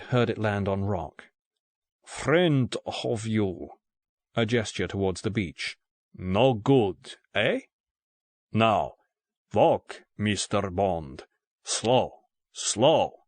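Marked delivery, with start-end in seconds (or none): 2.0-3.7 put-on very slow, Russian-accented broken English; thick Russian accent. A narrator's reading. Russian gunman mentions Bond's friend, thick Russian accent. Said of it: "in a thick Russian accent". 6.1-13.2 put-on measured Russian-accented orders, broken up by pauses; thick Russian accent. A narrator's reading. Russian gunman marches Bond off at gunpoint, thick Russian accent. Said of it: "in a thick Russian accent".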